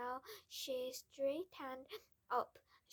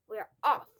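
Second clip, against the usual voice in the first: loud father; 16 dB louder than usual. Acted. Father voiced in story.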